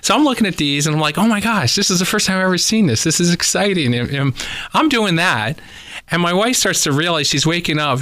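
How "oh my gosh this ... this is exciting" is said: higher-pitched retold thrill; excited. Guest reliving his first sight of drywood termites, excited. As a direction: recount your own excitement in a lifted, eager voice. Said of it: "what was exciting for me".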